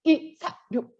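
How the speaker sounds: 이, 사, 육 chanted in separate beats; staccato. Shown as a demonstration. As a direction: clip 이, 사, 육 one by one. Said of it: "어떻게 해요?". (스타카토로 이, 사, 육)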